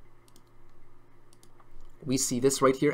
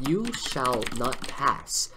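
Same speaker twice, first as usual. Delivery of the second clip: low, slow hint of Gandalf; mock Gandalf; 5 semitones lower, 5 dB quieter, much slower than usual. Explaining resistance with Gandalf, slow low imitation. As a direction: intone it slowly like Gandalf. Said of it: "he says".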